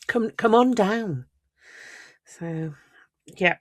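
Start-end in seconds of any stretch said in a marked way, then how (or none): none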